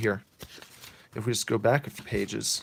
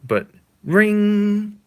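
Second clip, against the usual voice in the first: high, loud mock ring; doorbell imitation; 11 semitones higher, 9 dB louder than usual. Imitating the doorbell interrupting the kiss, high ring. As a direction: sing the ring like a bell.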